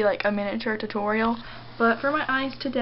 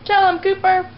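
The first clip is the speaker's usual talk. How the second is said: excited pet talk; 8 semitones higher than usual. Real, not acted. Owner urges Cooper to bark at the mirror, high squeal. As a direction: pitch way up and egg the dog on.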